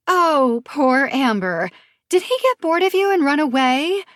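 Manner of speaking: high mocking fake sympathy; sarcastic pity. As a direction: pout it out sarcastically. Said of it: "Her sympathetic pout oozes sarcasm".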